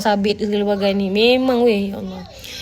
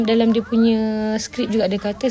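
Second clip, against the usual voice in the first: ordinary talk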